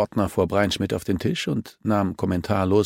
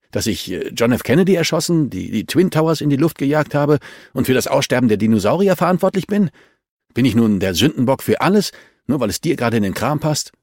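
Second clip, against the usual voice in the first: sarcastic outburst; 6 semitones higher, 6 dB louder than usual. A narrator's reading. Suspect's sarcastic outburst, loud.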